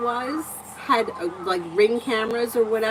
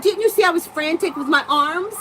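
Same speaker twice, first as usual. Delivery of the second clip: higher and louder, mock-outraged complaint; exasperated yelling; 9 semitones higher, 5 dB louder than usual. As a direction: replay the fight, voice pitched up in frustration. Retold argument with husband.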